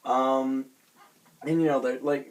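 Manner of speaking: ordinary talk, nothing marked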